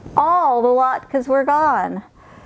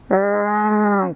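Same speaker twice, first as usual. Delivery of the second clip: bear growl. Acted. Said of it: "growled Old Bear".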